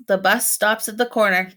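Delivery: ordinary delivery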